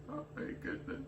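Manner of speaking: breathless whisper. Real, not acted. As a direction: whisper it, overwhelmed by the heat. Overwhelmed by spicy food.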